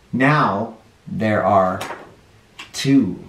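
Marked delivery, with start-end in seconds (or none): none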